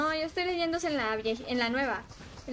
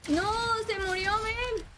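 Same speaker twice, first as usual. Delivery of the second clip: higher, sudden cry of dismay; dismayed outcry; 9 semitones higher than usual. Real, not acted. Streamer reacting to dying in game, dismayed cry. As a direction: yelp as your character dies.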